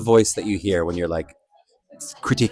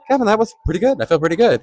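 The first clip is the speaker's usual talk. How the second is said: bright, higher encouraging self-talk; kind inner voice; 9 semitones higher than usual. Retold or imitated. Coaching himself after a dance take, in a bright, encouraging voice. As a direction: cheer yourself on lightly. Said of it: "the voice that now says".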